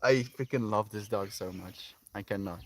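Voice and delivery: ordinary talk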